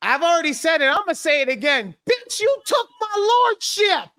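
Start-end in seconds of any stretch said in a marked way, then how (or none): 2.1-4.1 high, mock-furious shout; hammed-up outrage at a friend; mock outraged shout. Host roasting a friend for taking her lordship, mock shout.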